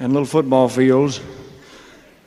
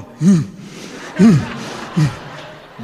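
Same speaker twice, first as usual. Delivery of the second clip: dog growl; 4 semitones higher, much slower than usual. Retold or imitated. Voicing the dog in heat.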